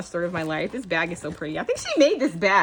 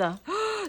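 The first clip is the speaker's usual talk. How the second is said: soft delighted gasp; 13 semitones higher, 6 dB quieter than usual. Real, not acted. Seeing charms in the dangle.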